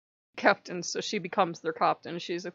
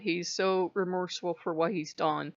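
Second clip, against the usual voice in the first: usual speaking voice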